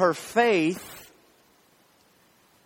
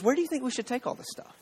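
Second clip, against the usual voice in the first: teasing mom; 8 semitones higher, 6 dB quieter, much faster than usual. Retold or imitated. Mom teasing her daughter.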